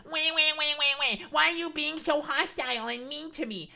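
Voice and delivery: high, loud whiny mock; hammed-up pleading crybaby voice; whiny victim. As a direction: mimic a hurt crybaby. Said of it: "And now you wanna".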